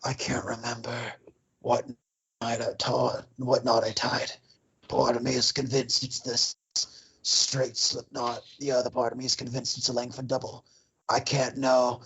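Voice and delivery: whispered, low brooding diary voice; Borden's diary read in a low hushed voice; quoting Borden. Retold or imitated. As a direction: murmur it like a haunted man's diary. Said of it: "Alfred Borden's like".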